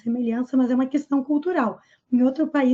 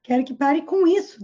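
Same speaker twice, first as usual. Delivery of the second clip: ordinary talk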